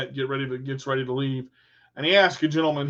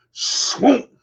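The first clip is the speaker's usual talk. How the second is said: a high, louder whooshing swoop; swoop sound effect; 7 semitones higher, 5 dB louder than usual. Retold or imitated. Telling friends how the fish hit the pole, a high louder swoop. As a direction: imitate the pole whipping down with a swoop.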